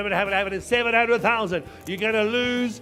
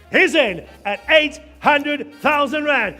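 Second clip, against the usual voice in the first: slow, loud, ringing call; loud announcement; 4 semitones higher, 5 dB louder than usual. Real, not acted. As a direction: proclaim it to the room. Announcing bid loudly.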